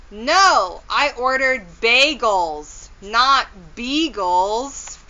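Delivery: "beagles" drawn out slowly; stress on beagles. Acted. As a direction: correct the caller, stretching "beagles". Correcting caller on phone.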